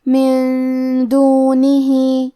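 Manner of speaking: من دونہ with nun hidden in a long nasal hum, ہ stretched two counts; very slow من دونہ, nasal nun and lengthened ha; slowly chanted tajweed of من دونہ; ghunnah on min. Shown as a demonstration. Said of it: "تو ہم یہ حکم کو غنہ کے ساتھ ادا کریں گے".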